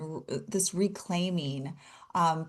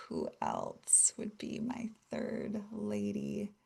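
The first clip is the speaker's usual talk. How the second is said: whispered, slow, to herself; 11 dB quieter, slower than usual. Real, not acted. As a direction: whisper the question to yourself. Musing aloud to herself.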